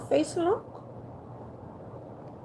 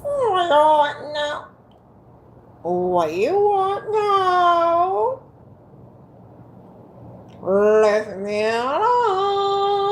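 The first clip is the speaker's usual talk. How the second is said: slow, whiny leave me alone; cranky granny; much slower than usual. Pretend. Granny shooing someone away, slow and whiny. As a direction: drag it out like a grumpy old lady. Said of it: "these expressions in my movies".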